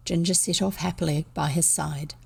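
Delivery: usual speaking voice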